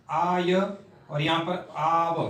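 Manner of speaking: slowly sounded "आय" and "आव"; slow careful pronunciation. Shown as a demonstration. Teacher pointing out sounds to students, slow and deliberate. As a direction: lay out "आय" and "आव" slowly so students hear each. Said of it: "थोड़े उच्चारण पर ध्यान दें".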